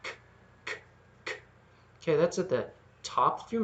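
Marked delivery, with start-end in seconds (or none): 0.0-1.3 k said as an isolated breathy click; repeated bare k sounds; K sound demo. Shown as a demonstration. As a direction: push air out behind k. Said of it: "Make the K sound in your mouth".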